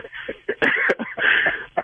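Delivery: airy, high helpless laughter; laughing at being fooled; breathless high laugh; high wheezing laugh. Real, not acted. Prank revealed, caller laughs.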